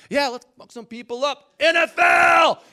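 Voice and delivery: rowdy mock war cry, high and loud; hyped-up yelling. Preacher voicing his manly side, hyped-up shout.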